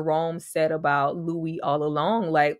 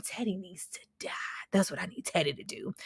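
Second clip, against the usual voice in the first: sinister whisper, no voice at all; whispered threat; 11 dB quieter than usual. Retold or imitated. Voicing a character's dark wish about Teddy, whispered. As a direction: breathe it like a secret wish.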